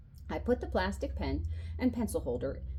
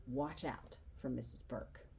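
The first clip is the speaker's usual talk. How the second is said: hushed, much softer warning; whispered warning; 5 semitones lower, 10 dB quieter than usual. A narrator's reading. Charlie warns the new boy about the teacher, softly whispered. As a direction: lean in and warn in a whisper. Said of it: "whispered to him".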